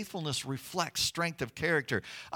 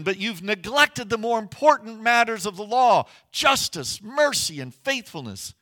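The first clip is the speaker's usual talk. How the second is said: louder, higher scolding from scripture; mild stern rebuke; 5 semitones higher, 5 dB louder than usual. Acted. Preacher voicing Jesus to the Pharisees, mildly stern rebuke. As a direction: read it as a pointed reproach.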